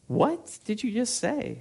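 high, slow incredulous question; baffled disbelief. Retold or imitated. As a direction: ask it in mock bewilderment. Acts out his baffled reply.

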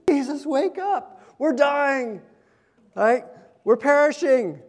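a hint of panicked disciples crying out. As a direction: call out to Jesus as if drowning.

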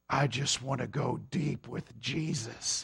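low, soft, slow parody of a youth-camp line; mock earnest cliché. Preacher mocking camp retreat piety to the congregation, hushed and low. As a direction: mimic the dreamy retreat kid, hushed. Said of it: "Have you ever heard that?".

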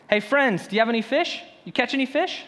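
calling across water. Retold or imitated. Jesus calling from shore.

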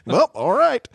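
high, loud goofy movie voice; goofy comedy character impression; Lloyd Christmas. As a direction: ham up the dopey movie line. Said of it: "we have a little Lloyd Christmas".

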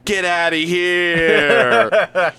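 slow, loud get-out; drawn-out shout. Acted. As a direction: drag it out and yell. Dismissing salesman in tirade.

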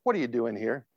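soft, low tender question; tender, hushed concern; gentle, kind God. Retold or imitated. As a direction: ask it softly, like a kind God. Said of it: "God would be so kind to say that".

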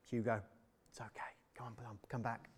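put-on hushed reassurance to a small child; coaxing a little boy back gently; tender to a child. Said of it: "It's tender as they pick up their child".

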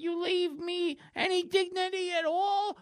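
high, stammering neurotic whine; Woody Allen. Acted. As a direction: plead like a nervous, put-upon nebbish. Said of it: "he kinda looks like Woody Allen".

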